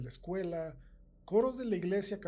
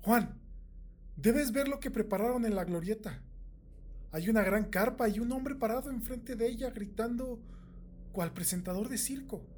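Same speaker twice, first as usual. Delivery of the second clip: louder, higher, urgent excited call; breathless excitement; 6 semitones higher, 5 dB louder than usual. A narrator's reading. Sara rushes into the store to tell Juan about the tent, breathlessly excited. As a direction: burst in with big news, excited. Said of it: "Sara estaba sin aliento".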